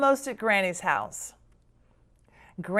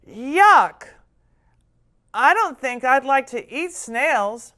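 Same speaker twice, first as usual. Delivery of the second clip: disgusted; 3 semitones higher, 4 dB louder than usual. Acted. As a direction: recoil in disgust. Child disgusted by snails.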